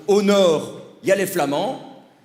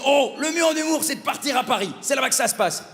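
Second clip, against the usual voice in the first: higher-pitched well-meaning advice giver; eager adviser; 5 semitones higher than usual. Retold or imitated. People advise the new comedian to move to Paris, higher imitated voice. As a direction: imitate someone urging a beginner on.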